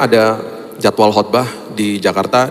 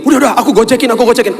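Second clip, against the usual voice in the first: much higher, louder, rushed and flustered; imitating a flustered wife, voice way up; 12 semitones higher, 4 dB louder, faster than usual. Flustered wife offers ride fare.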